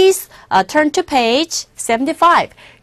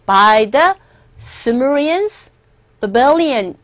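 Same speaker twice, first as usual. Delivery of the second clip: slow reading; much slower than usual. Shown as a demonstration. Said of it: "teacher reads text".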